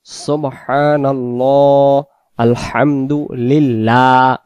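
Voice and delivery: very slow সুবহানাল্লাহ, আলহামদুলিল্লাহ with light thin l; slow recitation, light lam. Shown as a demonstration. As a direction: draw out each word, lam kept light. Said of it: "ওখানে আবার লা হবে".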